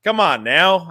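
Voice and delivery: ordinary talk, nothing marked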